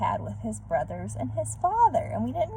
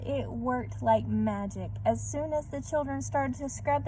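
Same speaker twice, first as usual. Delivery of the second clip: usual speaking voice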